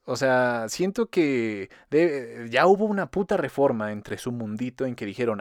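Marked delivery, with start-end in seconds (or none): none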